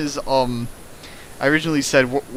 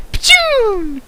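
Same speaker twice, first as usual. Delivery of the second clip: high, loud sci-fi blast sound; explosion noise; 6 dB louder than usual. Pretend. Narrator mimicking a bullet hitting. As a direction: spit out a video game zap.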